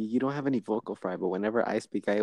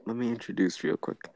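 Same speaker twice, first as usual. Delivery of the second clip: whispering; 3 dB quieter than usual. Real, not acted. Podcast intro aside.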